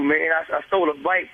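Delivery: ordinary delivery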